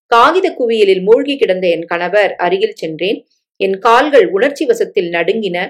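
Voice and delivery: usual speaking voice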